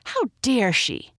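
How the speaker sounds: high-pitched, affronted outburst; indignant snap. A narrator's reading. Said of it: "the girl snapped".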